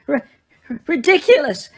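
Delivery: stuttering, sobbing cry, loud and high; sobbed. A narrator's reading. Mrs Weasley sobs the spell at the boggart, loud and high. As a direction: force the spell out through sobs. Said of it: "she sobbed again".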